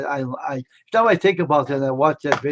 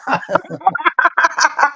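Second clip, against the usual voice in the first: laughing; 14 semitones higher, 6 dB louder than usual. Real, not acted. Laughs after a name mix-up.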